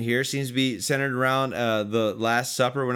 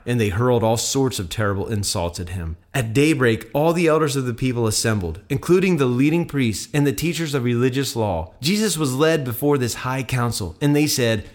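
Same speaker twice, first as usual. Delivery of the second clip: clear, projected scripture reading; Bible story narration; 4 dB louder, faster than usual. A narrator's reading. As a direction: read the gospel aloud with measured drama. Reads Bible passage aloud.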